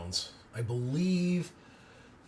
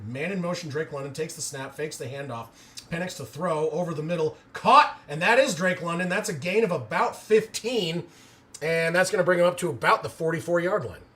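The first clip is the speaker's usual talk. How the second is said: live play-by-play; 3 semitones higher, 5 dB louder than usual. Acted. Streamer calling the Falcons drive live, play-by-play rising on the catch. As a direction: call the snap, then lift on the catch.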